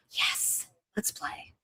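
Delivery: put-on excited kid's whisper; soft breathless delight; eager whisper. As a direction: whisper it like a thrilled child. Acting the kid inside him.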